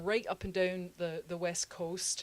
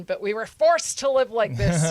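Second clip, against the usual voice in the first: much louder, rising in protest; indignant complaint; 3 semitones higher, 13 dB louder than usual. Retold or imitated. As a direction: hammer home the grievance loudly. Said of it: "Scottish people in there would be like".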